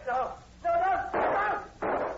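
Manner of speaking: high, loud protest; anguished cry. Acted. Horton, just shot by the sergeant, crying out in anguish. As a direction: cry out in despair.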